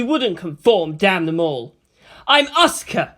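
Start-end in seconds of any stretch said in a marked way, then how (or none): 2.3-3.1 higher, louder defiant cry; inner shout. A narrator's reading. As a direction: shout it to steel yourself. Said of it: "she shouted deep into herself".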